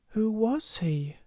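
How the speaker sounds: high, slow, wondering question; curious child. A narrator's reading. Ermengarde asking about the sixpence, curious child.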